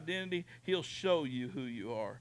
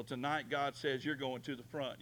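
ordinary talk

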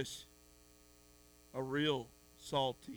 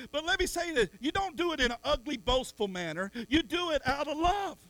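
higher, louder sermon cry; fiery preaching; 7 semitones higher, 6 dB louder than usual. Real, not acted. Preacher urging the church to be salty, high and loud. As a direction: build it up to the whole church.

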